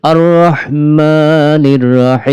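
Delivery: slow melodic tajweed chant; Quran recitation. Acted. Teacher recites the opening verses, slow melodic chant. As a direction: intone each verse, holding the long vowels.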